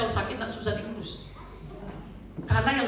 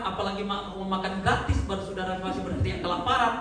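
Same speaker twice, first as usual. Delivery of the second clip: normal talking voice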